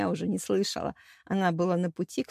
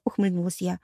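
ordinary delivery

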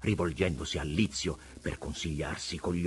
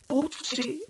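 wheedling rogue, raised and pitched high; vagabond Preola. A narrator's reading. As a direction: preach it like a sly tramp at God. Preola's voice in the story.

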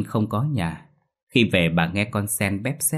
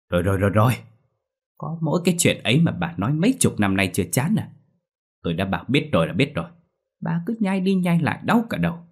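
higher, fast exasperated retort; irritated husband; 5 semitones higher, faster than usual. Acted. Husband fed up with wife's lecture, irritated retort. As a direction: snap back at a nagging wife. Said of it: "Ông Bảnh bực dọc".

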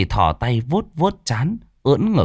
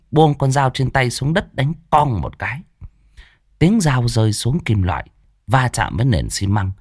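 usual speaking voice

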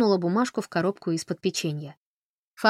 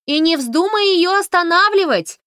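high, loud, slow shout; shouting after her; 12 semitones higher, 10 dB louder than usual. A narrator's reading. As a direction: yell it after someone leaving. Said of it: "крикнула ей вдогонку Фанни".

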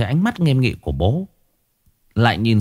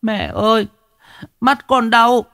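put-on much higher, a little girl whimpering in pain; crying child; 12 semitones higher than usual. A narrator's reading. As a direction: call for mum through the pain. Bé gái đau mắt gọi mẹ. (bé gái gọi mẹ, giọng cao, đau đớn)

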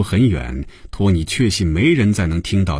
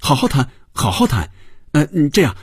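flustered, quick and high-pitched; eager hurried stammering agreement; hastily; 7 semitones higher, faster than usual. A narrator's reading. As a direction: scramble to agree, words tumbling out fast. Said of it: "托尼忙不迭地说".

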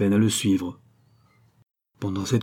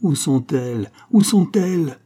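desperate shout, much louder and higher on the repeat; 7 semitones higher, 5 dB louder than usual. A narrator's reading. Count demands where the women are, building shout. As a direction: cry out, building in urgency. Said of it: "criait le comte".

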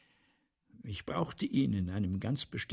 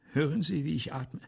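ordinary talk, nothing marked

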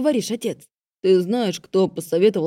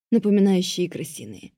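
usual speaking voice

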